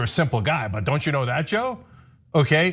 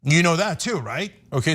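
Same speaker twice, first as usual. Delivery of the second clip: ordinary talk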